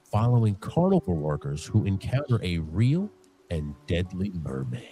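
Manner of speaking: ordinary delivery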